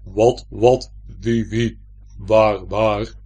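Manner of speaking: loud, weighty echo of a stammer; booming, low-set mimicry; heavy mimicking voice. A narrator's reading. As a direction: repeat the words in a big heavy voice. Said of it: "zegt de stem".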